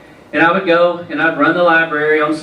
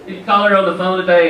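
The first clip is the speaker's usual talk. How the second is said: normal talking voice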